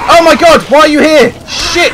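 high, loud shriek of alarm; startled scream. Real, not acted. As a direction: yelp in panic at a nasty surprise. Enemy suddenly appears while building.

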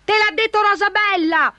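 very high-pitched indignant squawk; knight's high-pitched outcry; shriek. A narrator's reading. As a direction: shriek the accusation. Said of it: "strillò il cavaliere".

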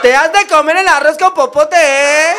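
"comer el arroz con popote" dicho agudo y fuerte, a lo chilango; chilango burlón. Retold or imitated. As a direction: remata la burla con acento chilango. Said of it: "Me dijo". (el chilango se burla, agudo y fuerte)

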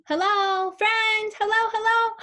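very high, loud, squeaky puppet greeting; Elmo voice. Acted. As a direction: greet the kids as an excited little red monster. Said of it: "It's Elmo".

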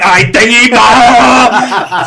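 loud, high and slow with laughter; boy cheering the scene loudly, laughing; delighted kid. Retold or imitated. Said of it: "the wee guy went".

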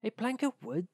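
high-pitched echoing question; acted surprise, voice lifted high; surprised miller. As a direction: repeat it with sudden high-pitched interest. Miller seizes on Hans's plank.

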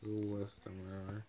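talking with a mouth full; words muffled by cookie. Real, not acted. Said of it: "there's something in my mouth".

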